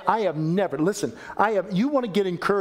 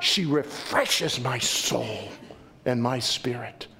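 tender and slow; 6 semitones lower, slower than usual. Real, not acted. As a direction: savor the words. Tender closing thought.

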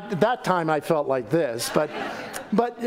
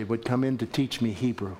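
ordinary talk

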